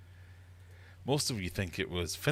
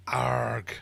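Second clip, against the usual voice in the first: mock gasp; 3 semitones higher, 5 dB louder than usual. Reacting to big point loss.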